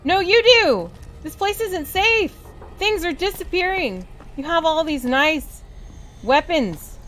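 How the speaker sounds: loud, high mock indignation; indignant retort. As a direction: snap back in high outrage. Scolding store guard, mock outrage.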